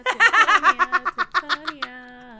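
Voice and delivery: loud, slow words broken by laughter; cracking up. Real, not acted. Co-host laughing at a misheard word, cracking up. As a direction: repeat it helplessly through laughter.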